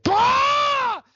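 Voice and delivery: high, loud hammed-up wail; mock sudden scream. Mocking the actress waking up in the crying scene, hammed-up scream. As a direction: burst out with a sudden exaggerated scream. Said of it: "immediately is like".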